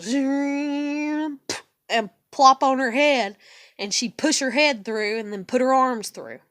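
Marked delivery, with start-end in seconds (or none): 0.0-1.4 put-on playful zooming airplane sound for a child. Mom playing airplane with her kid, playful zoom. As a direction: swoop the word like a toy plane. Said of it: "act like it was like an airplane".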